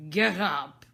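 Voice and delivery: loud barked order; the Queen shouts at the gardeners, loud; loud royal command. A narrator's reading. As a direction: bellow it down at them. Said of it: "said the Queen in a loud voice".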